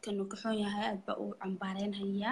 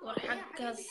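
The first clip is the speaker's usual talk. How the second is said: whispering; 6 dB quieter, slower than usual. Real, not acted. Host drops to an aside.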